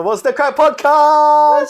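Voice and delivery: high, loud hyped podcast intro; announcer voice. Acted. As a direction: belt it like a show host.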